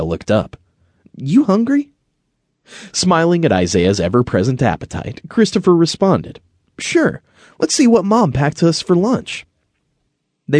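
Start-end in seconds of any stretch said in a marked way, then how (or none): 1.2-1.9 put-on high boy voice, rising question; storybook kid angling for lunch, high voice; hopeful boy. A narrator's reading. Boy asks friend in story. 6.8-9.5 put-on high, lively boy voice; storybook boy ready for lunch, high voice; agreeable boy. A narrator's reading. Boy replies to friend.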